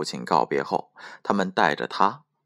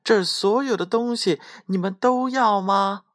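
high, piping holler; high sustained little-voiced yell; shouting with all might; 13 semitones higher than usual. A narrator's reading. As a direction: call out as if to wake the house. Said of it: "尽力大声喊道".

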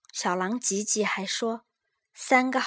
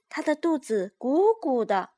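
ordinary delivery, nothing marked

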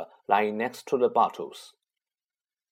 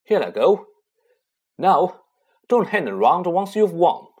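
loud, raised, resolute instructions; higher, forceful parting order; urgent send-off; 5 semitones higher, 6 dB louder than usual. Acted. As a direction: brace yourself and urge them onward. Ron giving last urgent instructions.

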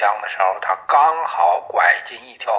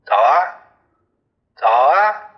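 louder, pressing him to walk away; commander's loud command; 3 semitones higher, 5 dB louder than usual. Acted. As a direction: push him off with a shout. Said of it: "连长这时对他说". (连长厉声催促)

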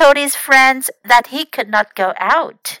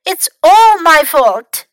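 loud, slow, higher-pitched lament; very upset; 5 semitones higher, 9 dB louder, slower than usual. A narrator's reading. Fox blames himself for Louis's fall, very upset. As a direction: wail it, leaning on ALL. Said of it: "Fox was very upset".

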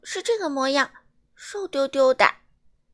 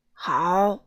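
calmer giant; 6 semitones lower, 4 dB louder than usual. A narrator's reading. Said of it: "巨人稍稍平静了些".

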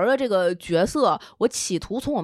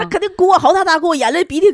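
excited; 8 semitones higher, 8 dB louder than usual. Real, not acted. Excitedly recounting her sobbing.

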